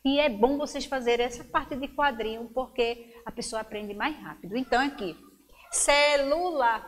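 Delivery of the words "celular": "celular" slowly, one beat per syllable; slow, measured syllables in "celular". Shown as a demonstration. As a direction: count out "celular" as ce, lu, lar. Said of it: "Quantas vezes abrir a boca?".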